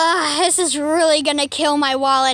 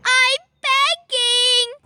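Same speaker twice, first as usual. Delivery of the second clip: high drawn-out begging; 8 semitones higher, 3 dB louder, much slower than usual. Acted. Poplio pleads.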